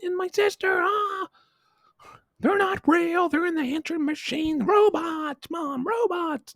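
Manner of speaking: put-on frantic kid. Little boy grabbing his mom, screaming about the robots. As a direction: shriek it, clinging to mom.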